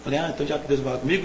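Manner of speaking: speaking to God in his head, softly; inner prayer. Retold or imitated. Young man tells God in his mind, quietly hushed. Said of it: "comecei a falar com Deus na mente".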